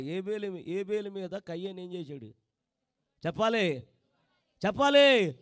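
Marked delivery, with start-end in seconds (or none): 4.7-5.3 loud demand. Real, not acted. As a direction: repeat it louder, pressing the crowd. (గట్టిగా అడగడం)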